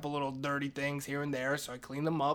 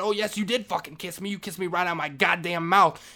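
loud, heated insistence, pitched up; shouted contradiction; 5 semitones higher, 10 dB louder than usual. Retold or imitated. Janitor contradicting Maria about the kiss, heated shout. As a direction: throw the truth back at full volume.

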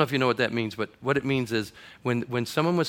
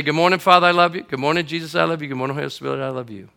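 morning prayer; 4 dB louder, faster than usual. Shown as a demonstration. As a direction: speak each greeting more gently. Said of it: "Here's my prayer".

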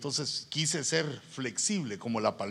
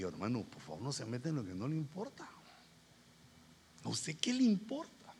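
restrained rebuke; 2 semitones lower, 8 dB quieter than usual. Retold or imitated. Retold restrained rebuke to meddler.

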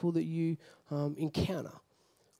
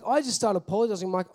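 ordinary talk, nothing marked

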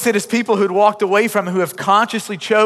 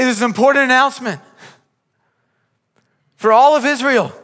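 preaching at full force; 5 semitones higher than usual. Acted. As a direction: proclaim it to the whole congregation. Preaching the call to return.

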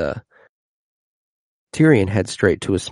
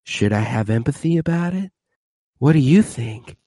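higher, sneering mock Joffrey voice; 5 semitones higher than usual. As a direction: tease the bride in a pitched-up sneer. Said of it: "he looks at Sansa like".

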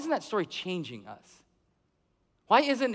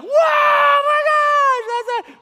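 very high, very loud, stretched-out scream of wonder; amazed shriek; 12 dB louder, much slower than usual. Retold or imitated. Crowd gasping at the peacock, shrieking very high and loud. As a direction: shriek in awe at the feathers. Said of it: "Everyone's like".

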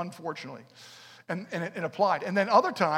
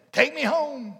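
pleading cry; 8 semitones higher, 8 dB louder, slower than usual. Acted. Simeon's plea repeated.

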